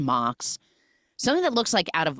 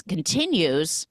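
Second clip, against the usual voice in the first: usual speaking voice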